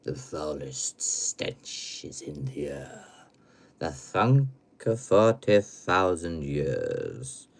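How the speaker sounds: slow, ominous low intoning with sudden swells; drawn-out macabre verse; spooky horror recital. Pretend. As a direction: recite like a horror-film host, dropping to whispers.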